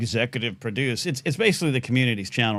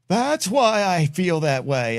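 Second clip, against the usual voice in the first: sudden realization; 4 semitones higher, much slower than usual. Retold or imitated. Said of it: "see me just go like".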